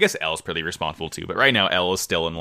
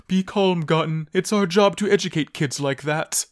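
lightly voiced Pegasus, a bit higher; a hint of a lofty Pegasus; 8 semitones higher than usual. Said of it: "he sounds like Pegasus in my mind".

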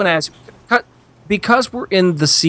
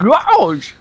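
higher-pitched Scooby-Doo exclamation; 6 semitones higher than usual. Acted. Trying out a cartoon voice for the others, Scooby-Doo impression. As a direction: do a quick cartoon-dog yelp like Scooby. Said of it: "Oh, wait, it's Scooby-Doo".

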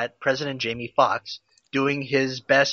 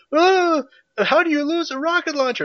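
high, loud cartoonish Channing Tatum; squeaky over-the-top Tatum voice; Channing Tatum impression; 4 dB louder than usual. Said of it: "That's my Channing Tatum impression".

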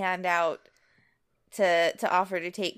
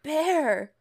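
heartbroken; 8 semitones higher than usual. Acted. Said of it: "[name] just sounds heartbroken".

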